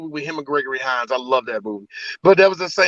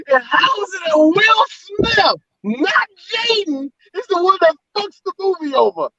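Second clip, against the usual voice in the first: mock indignation; 11 semitones higher than usual. Fan complaining about who ruined the movie, mock indignation. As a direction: wail the complaint in a high voice.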